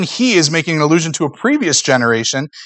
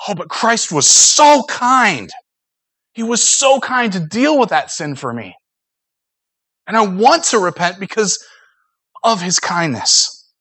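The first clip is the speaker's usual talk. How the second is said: tender prayer; 4 semitones higher than usual. Pretend. Model prayer to God.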